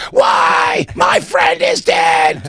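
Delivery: high, slow imitated yell of a grieving woman; retold shout. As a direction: mimic her crying out her grief. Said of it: "she's like".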